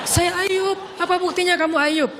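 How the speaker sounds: wife refusing to believe it's him; insistent denial. Retold or imitated. Wife questioning Ayub. (istri menolak percaya dengan suara tinggi)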